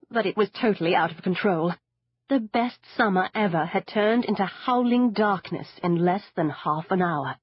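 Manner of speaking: ordinary delivery, nothing marked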